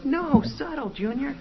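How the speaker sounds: high, exasperated mock scolding; gangster demon boss. Boss corrects Junior's clumsy try, gangster-style. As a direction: correct the rookie, pitched well up. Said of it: "act like gangsters".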